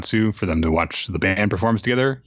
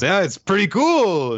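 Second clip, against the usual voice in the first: higher and louder, raised over a crowd; mock shout over music; 12 semitones higher, 4 dB louder than usual. Taiki leaning in at a loud concert, mock shouting. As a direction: call it out into her ear. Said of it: "just leans in and goes".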